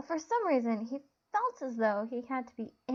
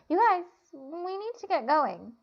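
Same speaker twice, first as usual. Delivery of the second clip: hollering; 6 semitones higher than usual. A narrator's reading. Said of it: "Helen hollered at the two men".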